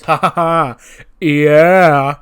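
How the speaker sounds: loud, high mocking laugh with a yelled yeah; cocky laugh. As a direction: cackle then bellow yeah. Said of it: "He goes".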